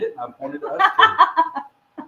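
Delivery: laughing. Real, not acted. Laughing defence of the age joke.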